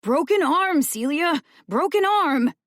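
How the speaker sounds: high, loud frantic cry; panicked yelling. A narrator's reading. Boy begging Celia to spare him, frantic yell. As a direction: blurt it out to save himself.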